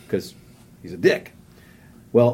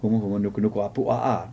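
Huma Huma Nuku Nuku Apua rattled off lower and quicker; said fast; 7 semitones lower, 7 dB quieter, faster than usual. Shown as a demonstration. Dared to say the fish name fast, rushed. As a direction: rush Huma Huma Nuku Nuku Apua like a tongue twister. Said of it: "Five times fast".

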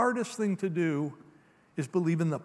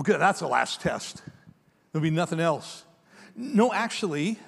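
ordinary talk

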